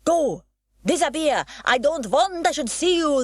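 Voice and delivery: put-on high, loud accented scolding; angry ringmaster. A narrator's reading. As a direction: shoo the girl away in anger. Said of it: "the ringmaster hissed".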